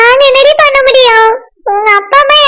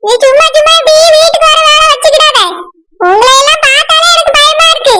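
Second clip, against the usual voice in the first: normal talking voice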